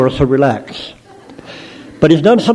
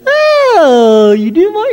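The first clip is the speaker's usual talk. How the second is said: put-on much higher, gushing falsetto girl; sweet melodious voice; 3 dB louder, much slower than usual. Mabel gushes in a high falsetto to her sweetheart Mort. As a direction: coo at him like a swooning girlfriend. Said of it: "And you say".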